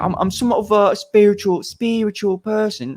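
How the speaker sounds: high Cockney Russell Brand imitation; 코크니 억양. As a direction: put on a high-pitched Cockney accent. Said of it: "I did the Cockney accent".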